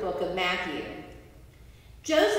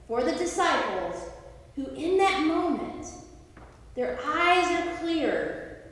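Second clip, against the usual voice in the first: ordinary talk, nothing marked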